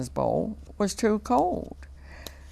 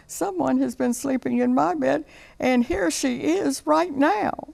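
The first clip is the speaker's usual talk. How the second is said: much higher, small cub voice; baby bear's wee little voice; 9 semitones higher than usual. A narrator's reading. Baby bear finds his broken chair, wee high voice. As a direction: squeak it like a tiny upset bear. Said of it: "in his wee little voice".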